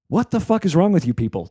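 exasperated, playful telling-off; mock outrage. Comic host scolds Australian listeners, mock outrage.

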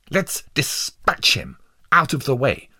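high-pitched, impatient snap; snapping. A narrator's reading. Juror urging a speedy execution, snapping. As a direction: urge it sharply. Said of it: "snapped Mister Hate Light".

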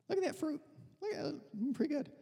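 high, soft coaxing voice; tempting devil. Acted. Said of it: "He comes and he says".

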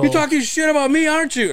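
high put-on accusing voice; mock affronted caller, high; Howard. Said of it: "that's how Howard talks".

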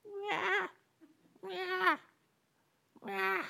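high squeaky little mews; kitten meow. Retold or imitated. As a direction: mew like a lion cub trying to roar. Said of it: "it sounds more like a cat".

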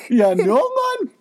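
high, loud copy of a bad Geordie; mimicked Geordie attempt. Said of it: "your Geordie accent".

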